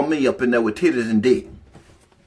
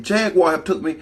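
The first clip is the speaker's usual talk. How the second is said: ordinary delivery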